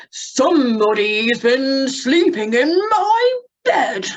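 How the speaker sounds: slow, loud, crying bear voice; Daddy Bear voice. Shown as a demonstration. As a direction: wail it like an upset papa bear. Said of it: "he goes".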